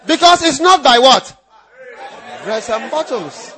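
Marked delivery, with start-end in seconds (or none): 0.1-1.4 loud, fervent preaching; loud preacher's fervour; preaching at full force. Acted. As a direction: drive it home to the congregation.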